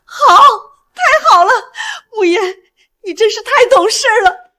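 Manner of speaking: high-pitched, delighted relief; 喜出望外. Acted. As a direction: gush with joy and praise. Mother delighted by agreeable child. (喜出望外，高声欣慰地夸赞)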